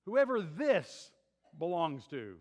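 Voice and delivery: milk the pause before "belongs to"; slow dramatic reveal. Shown as a demonstration. Preacher acting out how Tamar said it, slow and loud on "Whoever this". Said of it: "that's my picture on this".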